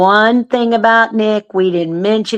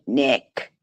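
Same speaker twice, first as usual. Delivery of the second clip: spirit box voice; 7 semitones lower, 7 dB quieter than usual. Retold or imitated. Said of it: "you hear this man say".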